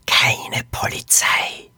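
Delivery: slow, eerie whisper. A narrator's reading. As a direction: whisper it drawn out like a ghostly voice. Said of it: "hörte sie die Stimme flüstern". (langsames, unheimliches Flüstern)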